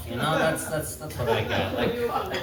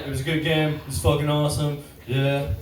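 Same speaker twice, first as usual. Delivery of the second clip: dumb jock voice; 3 semitones lower than usual. Retold or imitated. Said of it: "just being like".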